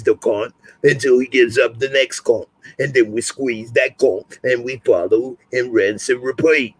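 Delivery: a hint of Billy Butcher's gruff London voice; Butcher impression. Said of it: "do the butcher one more time".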